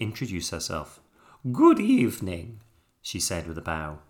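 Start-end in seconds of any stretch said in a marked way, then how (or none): 1.5-2.6 loud, higher theatrical greeting; grand stage hostess. A narrator's reading. Hippo ballerina opening her show, grand greeting.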